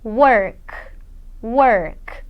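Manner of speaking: teacher modelling the voiceless k; voiceless ending. Shown as a demonstration. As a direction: work ending on a breathy unvoiced k. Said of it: "colocar a sua mão na garganta".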